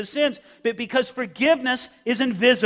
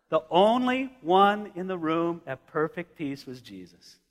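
normal talking voice

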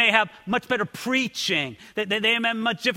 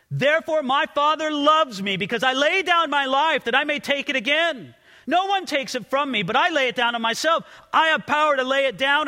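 raised, ringing voice reading the Gospel; scripture reading; 7 semitones higher, 4 dB louder than usual. Acted. Pastor reads Jesus' words to the church, loud and ringing. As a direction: proclaim it louder, like from the pulpit.

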